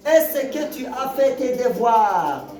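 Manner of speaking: slow rising question tune on devoirs; teacher reading a question to class, slow questioning tune. Shown as a demonstration. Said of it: "au ton".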